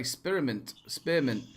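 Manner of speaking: "Spearmint" said first as spi-ri-mint, then shorter; said wrong. Shown as a demonstration. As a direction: show your odd, quiet "Spearmint". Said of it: "stuff that I say wrong".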